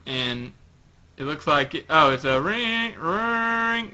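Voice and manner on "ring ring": ringing sound that slides up high; drawn-out voiced ringtone; imitated phone ring. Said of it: "like a phone ring".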